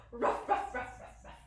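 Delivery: rapid husky ruffs, fading; dog bark. Shown as a demonstration. Teacher showing children what doggies say, quick playful barks. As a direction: bark like a doggy, trailing away. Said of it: "What do doggies say?".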